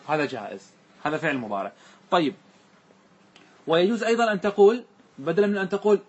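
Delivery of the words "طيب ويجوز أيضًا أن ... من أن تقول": ordinary talk, nothing marked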